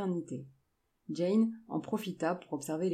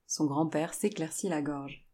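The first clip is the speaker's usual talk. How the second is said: ordinary delivery, nothing marked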